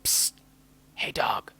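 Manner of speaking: whispered call; a stranger whispering from a tree. Acted. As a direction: hiss for his attention. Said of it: "a voice whispering".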